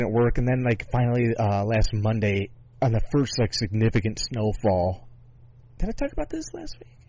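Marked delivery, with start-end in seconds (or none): 5.8-7.0 surprised. Real, not acted. Sudden high self-question aside.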